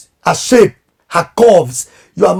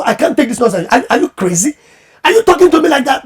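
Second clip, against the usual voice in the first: building to a high, fast outraged question; mock offended shout; indignant outburst; 6 semitones higher, faster than usual. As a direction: act out a spouse losing patience. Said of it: "And I go".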